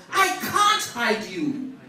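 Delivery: preached refusal, firmly projected; a hint of the rock crying out. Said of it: "But the rock cried out".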